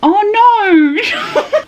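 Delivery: high, drawn-out "oh no" wail; dismayed wail. Real, not acted. Reader dreading what comes next, high dismayed wail. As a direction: squeal it in dismay. Reacting to the book's ending.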